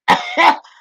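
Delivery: high, loud burst of laughter. Real, not acted. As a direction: burst out laughing at your own story. Laughs at her own quitting story.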